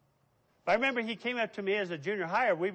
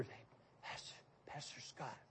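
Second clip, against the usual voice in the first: breathy timid whisper; imitated whisper; 6 semitones lower, 20 dB quieter than usual. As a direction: whisper it shyly like a nervous boy. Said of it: "he'd whisper".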